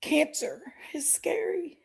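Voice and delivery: whispered through tears; fighting back tears, almost no voice; tearful whisper. Real, not acted. Telling viewers her fear, whispering through tears. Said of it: "I don't know why I'm getting all emotional".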